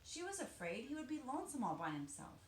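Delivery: plain reading voice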